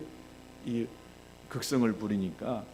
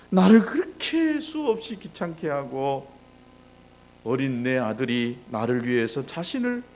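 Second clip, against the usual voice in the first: acted wailing; 5 semitones higher, 8 dB louder than usual. Father asking why his son would die for him, anguished wailing. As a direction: imitate a grieving father's loud outcry. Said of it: "통곡하면서 웁니다".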